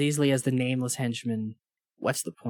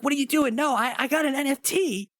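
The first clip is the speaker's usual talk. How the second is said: begging villain; 9 semitones higher than usual. Retold or imitated. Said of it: "He's like".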